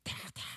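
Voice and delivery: two soft, quick tapping sounds; imitated staff strikes. Moses striking the rock twice, imitated taps. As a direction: mimic two knocks, very quietly.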